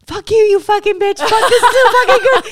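high, loud squealed swearing through laughter; gleeful cursing. Retold or imitated. Friend cursing while holding her birthday gift, gleeful shout. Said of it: "the whole time I'm holding it, I'm going".

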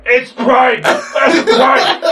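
high-pitched mimicked scream of alarm; panicked yell. Chandler warns everyone while running out, panicked yelling. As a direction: shriek the warning as a fleeing roommate. Roommate flees the sprayed skunk.

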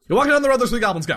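high, loud auctioneer rattle. Pretend. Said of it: "like, an auctioneer".

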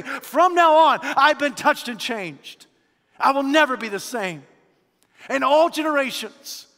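loud, high, ringing sermon voice; preaching at full force. Acted. Preacher exhorting the Christmas congregation, loud and high. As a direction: proclaim it to the whole church.

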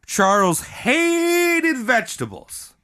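"hated" stretched very long on a high pitch; drawn-out emphasis on HATED. Real, not acted. Storyteller on Charles's hunger, "hated" drawn out high for emphasis. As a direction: hold the first vowel of "hated", high and long. Storyteller exaggerates the key word.

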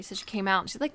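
normal talking voice

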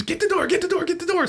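rapid, high-pitched urgent repeats; retold panicked command. Dad with burning can yelling to his kids, mock panic. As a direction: imitate a dad frantically ordering the kids.

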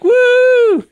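very high, loud "woo"; whooped cheer. Pretend. Host hyping own introduction as Player One, whooped cheer. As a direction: whoop like a hype man.

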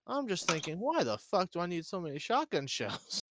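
ordinary talk, nothing marked

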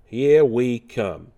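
slow New York "here" without the r; New York inflection. Shown as a demonstration. Showing viewers where Mario sounds New York, New York inflection. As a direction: draw out "here" as a New Yorker. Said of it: "where I get the New York inflection".

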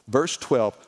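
ordinary delivery

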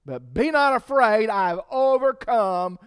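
loud, high, driving sermon voice; full-force preaching. Acted. Preacher warning the church about bitterness, loud and high. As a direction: preach it hard to the congregation.

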